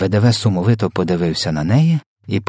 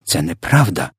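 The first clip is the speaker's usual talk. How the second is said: ordinary delivery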